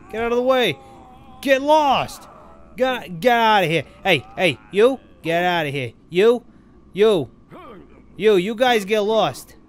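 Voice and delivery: loud, barking get out of here; shouting them off. Acted. Gamer shouting at characters to clear off, loud commands. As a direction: chase the crowd off with loud shouts. Yelling at game characters to leave.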